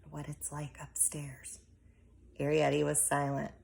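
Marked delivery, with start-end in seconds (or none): 0.1-1.6 a frightened hushed whisper; fearful whisper. A narrator's reading. Homily warning Arrietty, in a fearful whisper. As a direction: breathe it as if someone might hear. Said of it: "She dropped her voice to a fearful whisper".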